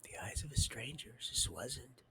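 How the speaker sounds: hushed, breathless whisper. Real, not acted. Speaker recalling a stranger's eyes, whispered. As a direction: whisper it as if seeing it again.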